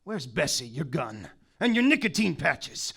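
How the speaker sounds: ordinary talk, nothing marked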